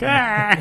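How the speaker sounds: short high yelled "Ah!"; a hammed-up shriek of panic; mock scream. Imagined victim screaming in waste.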